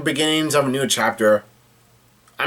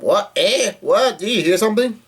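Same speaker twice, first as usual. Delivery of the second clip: high-pitched mock yell of alarm; mock startled yell; 7 semitones higher than usual. Recapping Usopp as he notices something, mock startled yell. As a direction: imitate a startled cry, pitched well up. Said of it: "and Usopp yells out".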